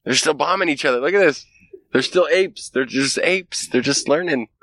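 mock alien voice. Aliens watching humans bomb each other, high mocking voice. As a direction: sneer at the apes from on high. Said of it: "they're like".

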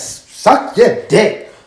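slow, drawn-out mock of her tone; incredulous girlfriend, slowly; mocking woman's reply. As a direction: drag it out like she can't believe it. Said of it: "She says".

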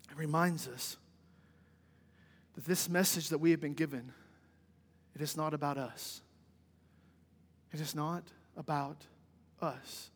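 low, soft and measured, with pauses; solemn preaching. Real, not acted. Preacher asking congregation how this impacts evangelism, soft and solemn.

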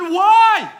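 very high, very loud, baffled yell; mock exasperated scream. Dad questioning daughter's fork, mock exasperated scream. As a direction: scream the question in acted despair.

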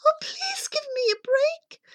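put-on very high little-girl plea; hammed-up frightened squeak; pleading cry. A narrator's reading. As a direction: beg for your life in a tiny high voice. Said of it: "She cried".

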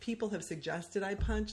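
ordinary delivery

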